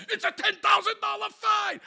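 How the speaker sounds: high, very loud imitated shout; yelling cop. Cop threatening the litterer with a fine, screaming. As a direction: scream it like an outraged officer. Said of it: "he's t-, yelling, screaming at them".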